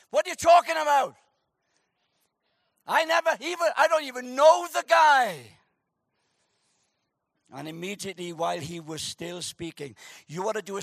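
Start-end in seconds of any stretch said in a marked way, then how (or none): 0.1-5.6 flustered denial. Acted. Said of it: "Peter's response".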